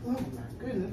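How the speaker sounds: usual speaking voice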